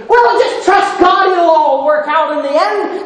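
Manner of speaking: high, sing-out mock piety; super-spiritual mockery. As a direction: parody someone handing out pious comfort. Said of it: "inhumanly, super spiritually saying".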